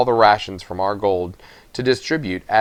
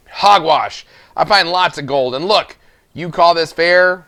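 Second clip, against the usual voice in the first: put-on angry old man; 7 semitones higher, 4 dB louder than usual. Rupert shouting at miller.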